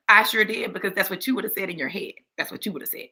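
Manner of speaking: low, quiet sassy comeback. Acted. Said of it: "he gets sassy right back".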